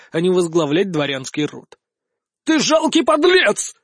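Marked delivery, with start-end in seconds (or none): 2.4-3.7 high, slow screeching outburst; shrill screech. A narrator's reading. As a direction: screech it out like a madman. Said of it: "выпалил он каким-то визгливым голосом".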